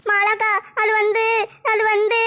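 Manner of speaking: slow, hesitant, dragging out வந்து; hesitant drawl. Acted. Simran hesitating to tell Mala akka, slow dragging stall. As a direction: stall nervously, stretching the words out. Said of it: "என்ன வந்து போயின்னு இழுத்துக்கிட்டு இருக்க".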